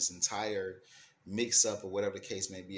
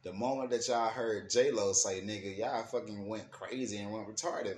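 ordinary delivery

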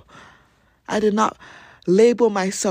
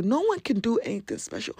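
normal talking voice

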